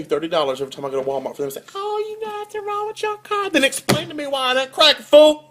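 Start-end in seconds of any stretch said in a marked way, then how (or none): 1.7-3.5 high falsetto dismissive mechanic; mocking the mechanic. Imitating the Walmart mechanic to the customer, high mock voice. 3.5-5.4 high, rising to a loud mock yell on fool; mock angry retort. Customer scolding the Walmart mechanic, mock angry.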